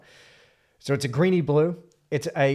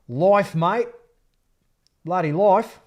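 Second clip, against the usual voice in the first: loud, high Aussie bloke voice; hammed-up Australian twang, raised and loud; Aussie accent; 6 semitones higher, 8 dB louder than usual. Artist musing to stream viewers in a loud Aussie accent. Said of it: "stop doing the Aussie accent, [name]".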